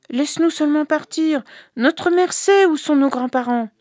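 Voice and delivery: put-on high, pleading children's voices; eager children. The sons pleading with their father the prince, eager. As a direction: beg the father to let them go.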